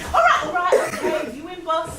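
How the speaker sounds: high, laughing while talking. Real, not acted. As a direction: concede through laughter. Laughing, giving in.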